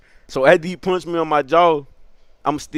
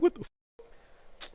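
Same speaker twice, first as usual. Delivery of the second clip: hushed, unfinished "what the f-"; stunned mutter; 14 dB quieter, much slower than usual. Retold or imitated. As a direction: trail off in quiet disbelief. Shocked muttered reaction in the story.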